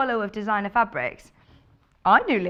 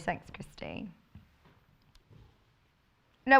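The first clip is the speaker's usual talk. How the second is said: normal talking voice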